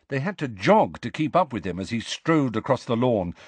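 usual speaking voice

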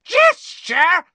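high outraged shriek. Acted. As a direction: screech the word. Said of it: "She shrieked".

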